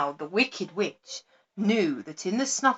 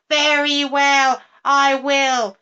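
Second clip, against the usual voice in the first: higher, louder, slow sneering agreement; mocking witch; 6 semitones higher, 8 dB louder, slower than usual. A narrator's reading. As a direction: sneer it slowly as a wicked promise. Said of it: "she cried".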